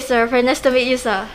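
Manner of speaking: ordinary talk, nothing marked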